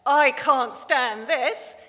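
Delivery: a dad worn out by the snoring, dragging each word; fed-up Daddy Bear. Acted. Reading to the congregation, a fed-up Daddy Bear.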